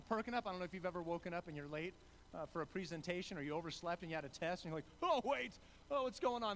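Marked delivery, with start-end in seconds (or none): 5.0-6.6 higher and louder, a sudden startled mock panic; panicked waking. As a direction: jolt awake late, voice jumping up and louder. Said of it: "that fear and panic starting to well up".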